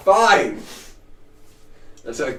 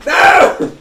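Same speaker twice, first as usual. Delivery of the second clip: high unvoiced shriek; shriek of dismay; 10 dB louder than usual. Real, not acted. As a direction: squeal out a horrified no. Reacting to Tiamat joke.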